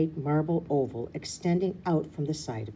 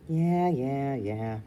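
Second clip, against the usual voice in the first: put-on bored child voice; much slower than usual. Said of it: "Rue says".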